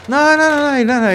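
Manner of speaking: high, quick run of no's; excited denial. Real, not acted. Cutting in on the shocked cellmate, eager denial. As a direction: wave off the worry, laughing it off.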